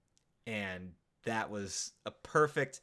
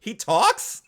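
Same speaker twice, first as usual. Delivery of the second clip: astonished; 10 semitones higher, 10 dB louder than usual. Retold or imitated. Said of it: "talking over him going".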